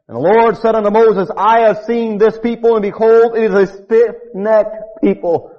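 high, loud thundering scripture; upset God rebuking his people; preaching at full force. Acted. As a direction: bellow God's wrath from the pulpit.

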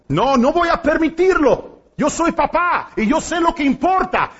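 usual speaking voice

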